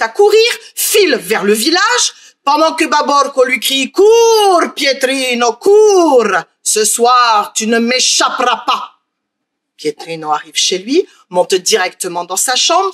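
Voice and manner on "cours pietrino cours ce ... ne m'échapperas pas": menacing ogre shout. Acted. Ogre yells after fleeing boy.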